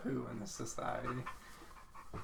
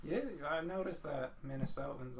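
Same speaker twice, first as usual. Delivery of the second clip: ordinary talk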